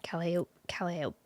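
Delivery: whispered stumbling practice; whispering. Shown as a demonstration. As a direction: whisper Calliope, restarting. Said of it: "I need to hear it again".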